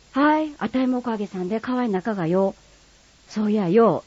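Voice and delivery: Kagoshima dialect. Acted. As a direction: greet an old friend in broad Kagoshima dialect. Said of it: "鹿児島弁は".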